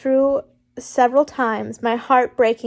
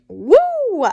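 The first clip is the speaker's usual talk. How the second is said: high, loud mock whoop; thought-bubble woo, giddy and high; excited woo; 9 semitones higher, 6 dB louder than usual. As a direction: let out a cheeky high woo. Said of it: "my mind went".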